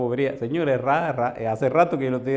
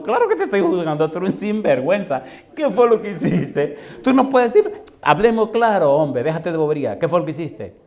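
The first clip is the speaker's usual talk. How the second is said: loud, higher mock scolding with a laugh; scolding shout; 4 semitones higher, 6 dB louder than usual. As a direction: call out the bandit, half laughing. Retold scolding of a backslider.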